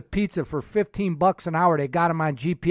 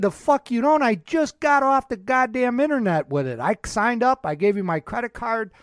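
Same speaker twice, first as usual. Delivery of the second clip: retold angry rant; 6 semitones higher, 3 dB louder than usual. Retold argument at rental counter.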